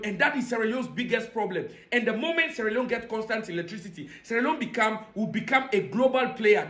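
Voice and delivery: ordinary talk, nothing marked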